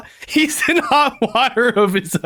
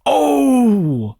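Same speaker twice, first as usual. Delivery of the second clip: flustered shopkeeper; 6 semitones higher than usual. Acted. Shopkeeper escaping questions by closing shop, high hammed-up dismay. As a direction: wail it as you back out of the deal.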